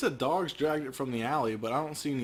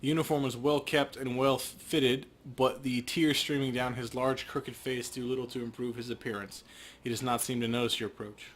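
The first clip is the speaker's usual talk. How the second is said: scene narration. A narrator's reading. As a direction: set the scene calmly.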